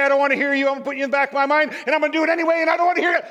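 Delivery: high, loud, racing refusal; defiant outburst. Acted. Acted defiance of inner voice.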